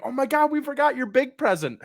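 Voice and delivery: high-pitched mock excitement; intense build-up. Parents building up one last present, mock excitement. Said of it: "the workup for it was so intense".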